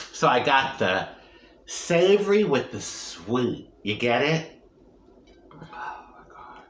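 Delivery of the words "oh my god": whispering. Real, not acted. Whispered reaction to taste.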